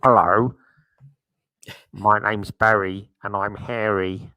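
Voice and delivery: slow, low put-on caller voice; lightly voiced prank caller, slow and low; imitating a caller. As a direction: drag it out like a daft radio caller. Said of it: "someone phoned in once and they said".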